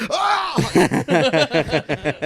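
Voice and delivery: high mock yelp of fright; imitated startled scream. Bruce hit by an empty bottle, imitated scream. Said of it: "he was like".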